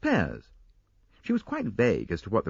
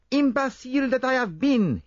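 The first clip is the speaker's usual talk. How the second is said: much higher, louder cry at himself; self-reproaching cry; 5 dB louder than usual. A narrator's reading. Poirot on the platform cursing his own mistake, loud high cry. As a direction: berate yourself aloud. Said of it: "he cried".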